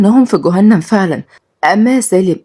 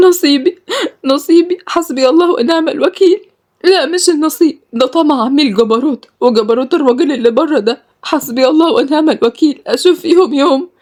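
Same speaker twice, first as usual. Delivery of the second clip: high, anguished cursing; bitter lament; 8 semitones higher than usual. A narrator's reading. Girl lamenting her fate and cursing her captors. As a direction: lament through grief.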